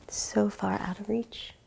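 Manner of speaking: very soft, whispery narration; hushed wistful aside. A narrator's reading. Reader shows how far the candy is, hushed. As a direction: drop right down to a near whisper. Storyteller hushes for distant prize.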